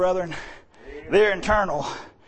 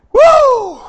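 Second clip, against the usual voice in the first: high joyful cry; excited whoop; 13 semitones higher, 11 dB louder than usual. Real, not acted. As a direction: let out a woo of praise. Preacher whoops.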